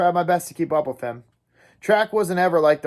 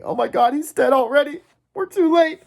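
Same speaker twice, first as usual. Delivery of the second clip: panicked dread; 11 semitones higher, 4 dB louder than usual. Acted. Thinking Johnny is dead.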